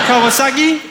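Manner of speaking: high, lilting Geordie with a rising end; Geordie accent. Shown as a demonstration. Comic demonstrating Geordie key words, high rising accent. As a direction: lift "Kawasaki" into broad Newcastle. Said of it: "trying to do the Geordie accent".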